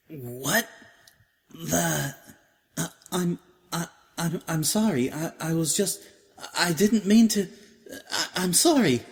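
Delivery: high, stammering mare caught out after a kiss; flustered Octavia. A narrator's reading. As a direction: stumble over every word, mortified.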